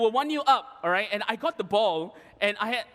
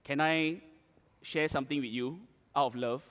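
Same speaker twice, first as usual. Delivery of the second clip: low, soft and sincere; quiet, caring aside; gentle advice; 6 semitones lower, 4 dB quieter than usual. Real, not acted. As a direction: offer it tenderly, voice lowered.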